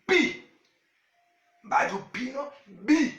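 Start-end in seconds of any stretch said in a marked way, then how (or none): 0.1-0.4 Bi said high and clear. Shown as a demonstration. Teacher models the letter Bi for his wife. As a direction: model Bi crisply for them to copy. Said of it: "nous dit avec moi".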